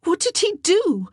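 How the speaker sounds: high, loud demanding question; urgent cry. A narrator's reading. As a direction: cry out, pressing for the answer. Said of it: "Anne cried".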